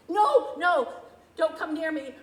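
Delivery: a loud, frantic cry to keep away; panicked refusal. Acted. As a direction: push them away in panic. Breakdown, pushing people away.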